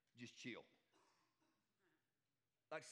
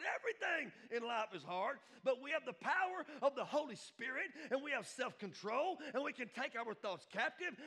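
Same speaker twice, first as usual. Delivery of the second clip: high, loud preacher's build; impassioned preaching; 9 semitones higher, 8 dB louder than usual. Real, not acted. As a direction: drive each clause home to the congregation.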